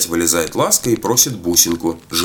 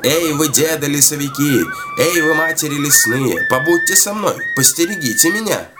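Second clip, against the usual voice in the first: calling out; 8 semitones higher than usual. A narrator's reading. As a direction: call into the forest for company, pitched well up. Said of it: "Принялась кричать". (протяжно зовёт высоким голосом)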